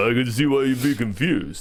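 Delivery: low action-star impression; Stallone. Joking reply to granny's question, low action-star impression. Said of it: "your Stallone".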